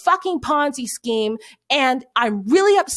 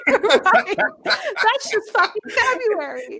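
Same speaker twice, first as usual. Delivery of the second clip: laughing it off, high and slow; breaking into laughter at herself; 7 semitones higher, slower than usual. Real, not acted. Laughing at her own rant to James.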